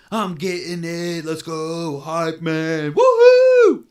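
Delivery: loud, high hammed-up hype; hyped anime fan. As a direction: cheer like an overexcited fan. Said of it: "Well, not like that".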